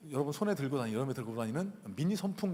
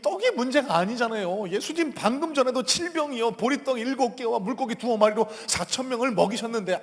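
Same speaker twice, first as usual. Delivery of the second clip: higher-pitched, aggrieved acted complaint; protesting disciple; 7 semitones higher, 6 dB louder than usual. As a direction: protest as if unfairly scolded. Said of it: "제자들 입장에서 예수님을 한번 생각해".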